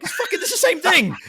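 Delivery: very high, loud laughing yell; exasperated outburst. Retold or imitated. Retelling a reply to German friend, comic exasperated outburst. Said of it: "I'm like".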